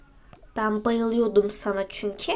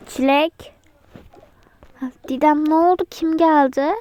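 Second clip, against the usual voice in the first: ordinary talk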